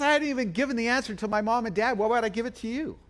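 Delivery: fast, high-pitched mock exasperation; retold quarrel, rushed and indignant; exasperated retort. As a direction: fire back like a badgered husband. Husband's retort in acted quarrel.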